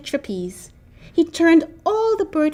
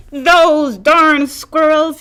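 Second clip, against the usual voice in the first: put-on slow, loud, drawn-out old man yell; fed-up old man cursing squirrels; 3 semitones higher, 8 dB louder, much slower than usual. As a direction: bellow it like a cranky grandpa. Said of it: "yelled Old Man Fukwire".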